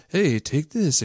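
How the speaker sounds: upbeat TV ad pitch, take ending on a falling "this". Retold or imitated. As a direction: sell it like a cheesy commercial. Mimicking a drug ad.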